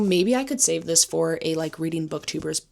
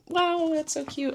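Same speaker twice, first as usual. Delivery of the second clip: very high, softened wonder; high-pitched, touched little coo; cooing delight; 11 semitones higher than usual. Real, not acted. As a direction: gush over something adorable. Delighted by the leaf she found.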